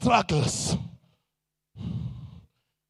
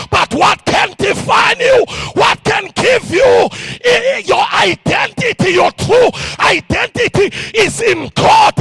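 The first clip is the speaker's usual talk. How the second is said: loud, high preacher's cry building in fervour; full-force preaching; 9 semitones higher, 5 dB louder than usual. Acted. Preacher shouting to the congregation, full-force sermon. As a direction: preach it out to the crowd, full throat.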